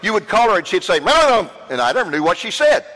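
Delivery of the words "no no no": gruff, loud mock phone greeting. Gruff woman answering the phone, loud and curt. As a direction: imitate a curt, unintelligible phone answer, pitched up. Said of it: "she was very gruff".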